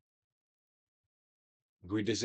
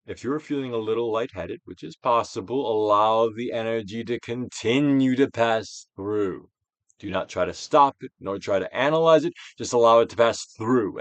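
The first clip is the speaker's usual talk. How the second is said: guided meditation; 4 dB louder than usual. Acted. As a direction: coax the listener to let feelings pass.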